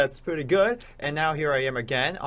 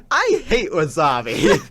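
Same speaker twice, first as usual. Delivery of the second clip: slow, louder mock disgust; 3 semitones higher, 6 dB louder, slower than usual. Retelling his wasabi regret, slow mock disgust. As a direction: drag out the complaint. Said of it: "I'm like".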